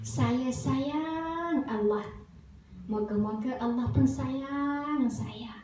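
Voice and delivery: very slow, stretched repeat-after-me phrases; prompting children. Acted. Father leading his children's bedtime words, slow prompting. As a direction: draw out the key word for children to copy.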